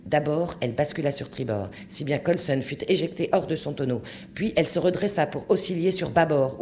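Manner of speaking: ordinary delivery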